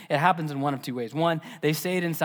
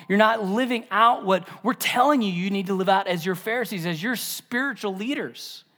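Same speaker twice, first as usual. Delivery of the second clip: raised pitch, insistent mock reproach; indignant scolding; 5 semitones higher, 4 dB louder than usual. Pharisees telling the disciples they are doing wrong, acted indignant scolding. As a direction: lay down the law as an offended Pharisee.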